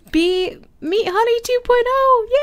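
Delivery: high, bubbly introduction; excited introduction. Real, not acted. Gamer introducing the new cat to Bear and Bee, high. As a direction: present the new cat with glee. Introducing cats to each other.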